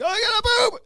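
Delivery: put-on high whiny kid voice, very high; little kid voice. Dad imitating his toddler son's urgent need. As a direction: squeak it like a desperate toddler.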